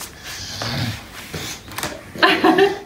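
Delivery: breathy low chuckle. Real, not acted. Laughing at the pig.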